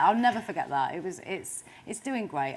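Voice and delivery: usual speaking voice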